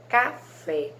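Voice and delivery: "café" split into ca-fé, stressing "fé"; stress on final syllable. Shown as a demonstration. Teacher showing pupils the strong syllable, "café" stressed on "fé". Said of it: "A sílaba que vocês falam com mais intensidade".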